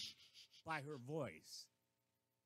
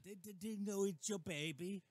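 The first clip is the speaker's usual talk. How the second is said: higher, louder Australian accent impression; hammed-up Australian accent; mock Australian accent; 4 semitones higher, 5 dB louder than usual. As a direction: quote it in a put-on Aussie accent. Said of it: "and then we get Australian".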